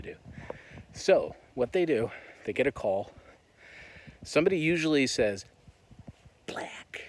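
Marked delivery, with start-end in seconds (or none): 6.5-7.0 secretive hushed whisper. Shown as a demonstration. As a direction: glance over your shoulder and whisper it. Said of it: "look behind your shoulder and whisper the word".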